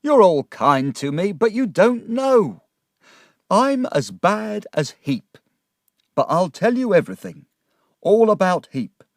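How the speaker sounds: loud, high-pitched despairing confession; distraught, tearful outburst; shouting and crying. Acted. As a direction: pour it out in distress, dragging the bitterest phrases. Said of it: "was shouting and crying now".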